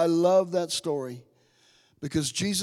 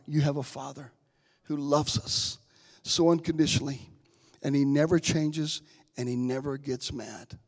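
ordinary talk, nothing marked

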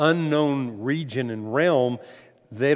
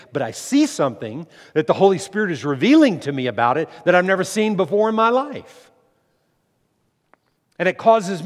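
loud, raised-pitch fervent testimony; impassioned preaching; 5 semitones higher, 8 dB louder than usual. Real, not acted. Preacher telling the congregation he wants to be continually filled, impassioned. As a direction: swell into forceful, urgent preaching.

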